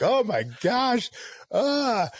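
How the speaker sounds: high, drawn-out overwhelmed "oh my gosh"; overcome gasp. Retold or imitated. Telling a friend how the show moved him, high overwhelmed gasp. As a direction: let the moment hit you, voice jumping up. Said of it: "and I'm like".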